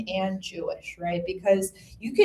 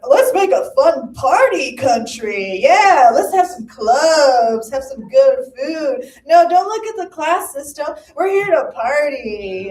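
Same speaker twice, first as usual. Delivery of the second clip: mock party colonizers; 3 semitones higher, 9 dB louder than usual. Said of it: "And they were like".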